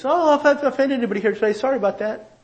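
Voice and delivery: flippant blanket apology. Retold or imitated. A speaker casually apologizing to the room, high and flippant. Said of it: "throw out these blankets".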